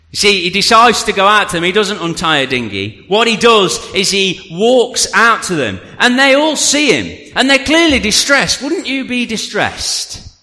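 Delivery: high-pitched, energetic sermon storytelling; animated preaching. Real, not acted. Preacher telling the church how Jesus walked out, animated. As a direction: preach it with drive and punch.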